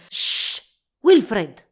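loud sharp shush, then the name snapped out; snapping. A narrator's reading. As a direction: shush him forcefully and cut him off. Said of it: "scattò l'uomo".

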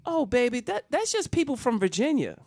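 high-pitched grandma covering up; breezy fibbing. Retold or imitated. Grandmother lying to her grandson about virgins, high feigned innocence. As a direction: toss off the lie in a high voice. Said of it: "instant lie".

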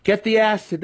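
high desperate cry; cried out. Acted. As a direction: blurt it out in panic. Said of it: "Alton cried".

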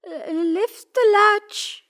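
very high, slow granny call; drawn-out high granny cry; grandmother crying out. A narrator's reading. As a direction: call out slowly in a high old-lady voice. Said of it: "cried the grandmother".

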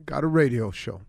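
loud, high booming radio host intro; grand entrance announcement. Acted. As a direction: announce yourself big over the music. Radio host's big opening.

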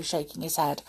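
ordinary delivery

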